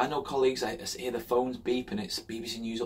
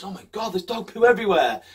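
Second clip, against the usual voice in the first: mock shock; 8 semitones higher, 7 dB louder than usual.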